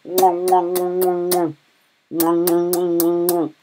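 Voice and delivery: droning, drawn-out nom-nom noises; munch along on one held note; mock munching noise. Streamer acting out Virginia on the map, mock munching. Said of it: "Virginia's just like".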